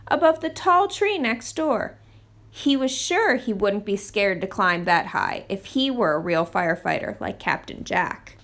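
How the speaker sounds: usual speaking voice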